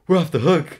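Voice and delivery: high, loud burst of relief; excited relief. Retold or imitated. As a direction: cheer like you just got away with it. Relieved kids sent back out.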